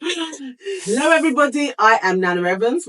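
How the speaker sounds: loud, high hyped hello; high excited greeting. Acted. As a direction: greet them up high and bright.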